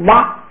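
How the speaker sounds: 哇 said on a high level tone, unaspirated. Shown as a demonstration. Teacher demonstrates 哇 in high tone for class. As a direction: give 哇 a clear high flat pitch. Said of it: "读成这个".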